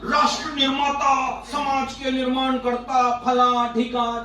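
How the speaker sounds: slow, drawn-out pompous titles; stretched-out mock ceremony; mock-grand. Mocks grand titles given to teachers.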